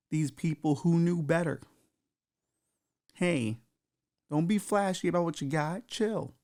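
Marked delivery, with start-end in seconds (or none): none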